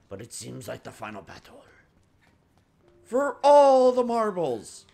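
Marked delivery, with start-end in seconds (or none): 3.0-4.8 higher, louder and slow, a grand pronouncement; epic dramatic voice. Pretend. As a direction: boom it out like the climax of a film. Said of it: "I don't know why I'm talking like this".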